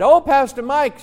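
high-pitched imitation of a delighted caller; lightly voiced Australian caller. Australian lady recognising the pastor by phone, lightly imitated, high-pitched. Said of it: "A lady from Australia called me one time".

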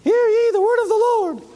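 squeaky falsetto prophet; mock the prophet with a silly high voice. Shown as a demonstration. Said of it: "Would you respect a prophet that sounded like that?".

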